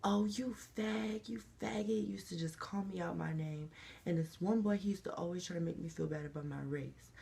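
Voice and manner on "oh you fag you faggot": slow, sneering schoolboy taunt, pitched higher; mocking bully taunt. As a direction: drag it out like a playground bully. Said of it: "the boys used to always be like".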